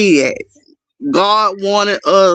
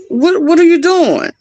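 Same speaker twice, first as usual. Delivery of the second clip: higher, sharp demand; confronting intruder; 9 semitones higher, 4 dB louder than usual. Retold or imitated. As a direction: challenge the kid in the yard. Son confronting girl in yard.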